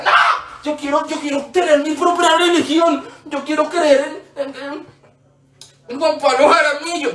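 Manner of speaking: put-on high, dragged-out kid protest; hammed-up child demanding their own way; mock childish tantrum. Acting out defiant child self, quarrel.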